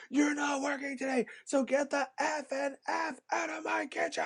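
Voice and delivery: mock-screaming chef throwing me out; chef yelling. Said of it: "he's like".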